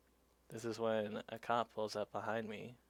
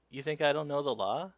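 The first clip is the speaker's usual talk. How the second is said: loud, higher mock challenge on “You think I don't know the law?”; indignant cop; 5 semitones higher, 9 dB louder than usual. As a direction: imitate an affronted officer, voice raised. Cop's repeated challenge, acted.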